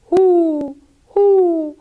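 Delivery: high, loud hoot; owl hooting. Acted. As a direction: hoot proudly. Owl hoots.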